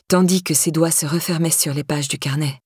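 usual speaking voice

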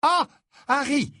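mother's ringing exclamation; loud exclamation. Acted. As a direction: cut in loudly to stop the chatter. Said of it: "s'exclama Missus Weasley d'une voix sonore".

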